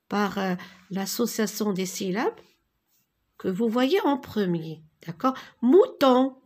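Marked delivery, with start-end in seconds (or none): 5.6-6.4 "Mouton" called out loud, syllable by syllable. Shown as a demonstration. A teacher calls out "Mouton" loudly to her class. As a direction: sound out "Mouton" loudly for the pupils. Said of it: "Alors, mouton".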